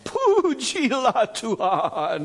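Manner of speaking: mock showy Indonesian praise, drawn way out; dragged-out preacher praise. Shown as a demonstration. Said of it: "drag it out and add extra syllables".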